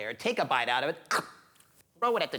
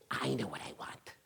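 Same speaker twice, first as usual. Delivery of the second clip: hushed, near-whispered decision; low-key and secretive, much softer; quiet realization; 4 semitones lower, 8 dB quieter than usual. Acted. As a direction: drop to a soft, knowing near-whisper. Old woman settles on real wish.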